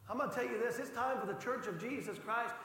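usual speaking voice

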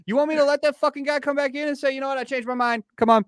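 high, loud mock telling-off; the speaker re-enacting how he yelled at the clerk; retold yell. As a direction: yell it back at the clerk like you did then. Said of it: "I was like".

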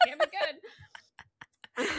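laughing through the slow repeat. Real, not acted. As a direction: let the words dissolve into a laugh. Laughing while repeating the quote.

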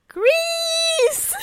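high, loud excited yell; excited shout. Pretend. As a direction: scream it like you made it. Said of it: "I'm gonna be like".

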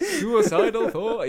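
high, loud and slow through laughter; laughing mock announcement. Replaying the song intro as promotion, laughing shout. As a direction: stretch it out, laughing loudly. Mocking own crowd cheer, laughing.

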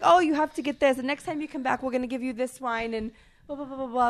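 high, loud eager offer; excited waiter. Retold or imitated. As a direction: gush like a jolly Italian waiter. Lively Italian waiter offering things.

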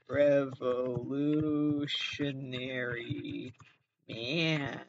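REVOLUTIONARY MAN stretched very slowly; drawn-out title. Acted. Podcaster tries out a title, Revolutionary Man drawn out slowly. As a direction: linger on every syllable of Revolutionary, pause, then Man.

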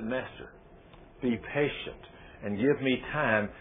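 soft, low begging plea; humble servant pleading softly. Acted. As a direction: beg the master quietly for time. Servant begging king in parable.

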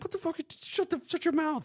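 mock angry son.

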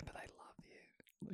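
soft unvoiced whisper. Real, not acted. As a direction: breathe it as a secret. Whispered aside.

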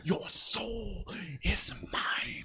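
breathy whispered villain line. Retold or imitated. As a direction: hiss it like a sorcerer.

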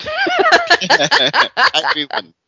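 high, slow, laughing imitation of a little kid; mock kid voice. Co-host teases by echoing the kid's mixed-up number in a high laughing voice. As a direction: stretch it out like a cute toddler, giggling.

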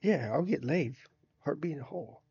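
ordinary talk

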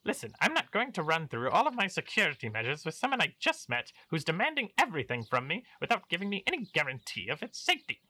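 pompous baron voice. Acted.

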